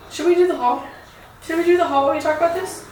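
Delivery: upbeat change of topic; perky. Real, not acted. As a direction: lift up into the haul. Switching to the haul.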